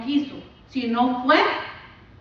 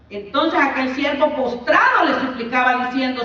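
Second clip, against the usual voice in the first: louder reading of the parable; scripture reading; 3 semitones higher, 4 dB louder than usual. Acted. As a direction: proclaim the parable to the congregation. Reads parable at preaching force. (lectura bíblica proclamada)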